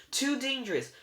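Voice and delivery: high, loud warning; alarmed protest. A narrator's reading. Sisters warning the boy off, alarmed. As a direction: protest with pitch shot up.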